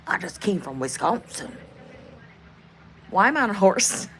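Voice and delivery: usual speaking voice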